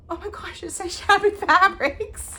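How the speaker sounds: high, slow, loud dismay; shocked. Retold or imitated. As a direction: stretch out the shock of the wrong label. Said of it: "I'm like".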